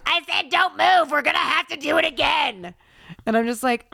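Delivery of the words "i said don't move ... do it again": chastising. Retold or imitated. TSA agent tells traveler off for moving, mimicked chastising. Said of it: "you're talking loud to chastise me".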